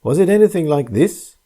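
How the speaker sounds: spring the reveal with a cry. A narrator's reading. Soba man revealing his own blank face, sudden cry. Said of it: "cried the sober man".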